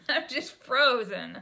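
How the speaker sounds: slow, loud, through laughter; laughing. Real, not acted. Laughing at her own panic with a friend, laughing. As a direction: laugh it out at yourself.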